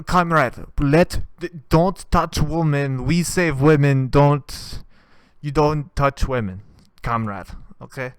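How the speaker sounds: hammed-up Ukrainian soldier ordering the volunteer; mock Russian soldier. Soldier warns the volunteer off women, loud mock Russian accent. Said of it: "one guy go".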